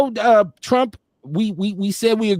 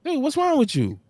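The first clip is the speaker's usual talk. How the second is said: retold surprise; 7 semitones higher than usual. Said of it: "And you said that".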